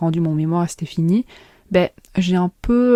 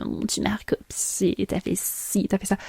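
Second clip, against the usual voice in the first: whispered mock accusation; 8 dB quieter than usual. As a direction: hiss it out like a petty accuser. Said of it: "en mode".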